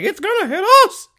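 very high, loud mock scream; piercing falsetto cry of alarm; imitated panicking woman. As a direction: shriek it as her in hammed-up panic. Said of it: "the bitch is like".

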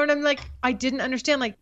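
normal talking voice

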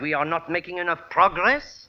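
puzzled French query; French accent. Acted. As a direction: ask in phony French. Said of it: "phony French accent".

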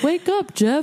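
high, playful wake-up call; high mock children's-show call. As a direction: call it out like a kids' entertainer. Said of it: "I feel like the Wiggles".

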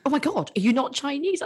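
high, shocked question; a hint of a flustered politician, surprised; mock surprise. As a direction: act genuinely taken aback. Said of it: "And he was like".

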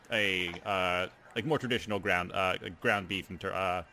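usual speaking voice

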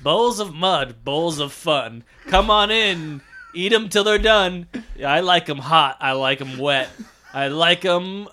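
sing-song rhymed verse; hammed-up food poem; poem recital. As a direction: recite in short lilting phrases, stretching the last. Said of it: "can I hear the poem for a bowl of mud".

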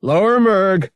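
high, loud shouted order; yelling. A narrator's reading. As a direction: yell the command down to a crewmate. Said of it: "Han yelled".

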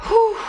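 high, forceful blown-out whew; long, airy "whew" of exasperation; exasperated whew. Real, not acted. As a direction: let out a big exhale of frustration. Venting exasperation at cancelled classes.

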